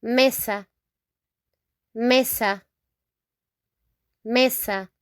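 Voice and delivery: mesa with pure e and a vowels; teacher modelling mesa; Spanish pronunciation. Shown as a demonstration. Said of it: "here we say".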